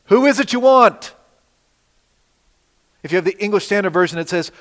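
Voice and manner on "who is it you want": higher, louder, ringing challenge; bold, louder take of the question; commanding call. Shown as a demonstration. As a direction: call it out like a king taking charge. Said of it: "That's our title for today".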